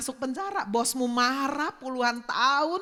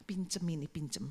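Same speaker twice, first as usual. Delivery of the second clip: inner voice; 8 semitones lower, 6 dB quieter than usual. Retold or imitated. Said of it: "Dalam hati gini".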